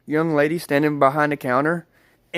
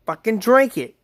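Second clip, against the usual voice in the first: retold pushy order, higher pitched; upset friend; 6 semitones higher than usual. Friend pushing a drink on the storyteller, mildly upset. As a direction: imitate an upset friend insisting. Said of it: "this time she's getting really upset".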